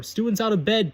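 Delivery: high, carrying alarm call; bellow. A narrator's reading. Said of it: "Peeves bellowed".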